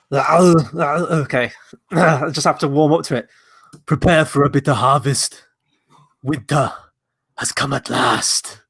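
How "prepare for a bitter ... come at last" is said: Arnie-style Mister Freeze, slow menacing drawl; Mister Freeze impression. Host voicing Mister Freeze for the quiz. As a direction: do a hammy Schwarzenegger villain. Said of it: "Mister Freeze from Batman and Robin".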